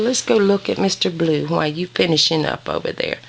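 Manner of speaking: ordinary delivery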